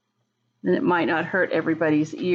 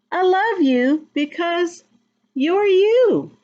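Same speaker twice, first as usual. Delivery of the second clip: put-on reading to children; 3 semitones higher than usual. A narrator's reading. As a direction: build to a joyful finish. Reading the book text.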